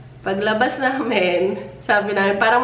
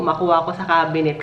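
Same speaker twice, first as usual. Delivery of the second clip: ordinary talk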